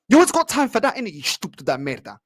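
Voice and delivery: loud, high-pitched angry burst; furious accusation. Real, not acted. Fan calling out the manager's excuse, furious.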